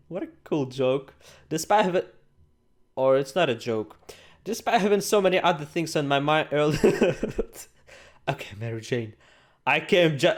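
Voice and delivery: normal talking voice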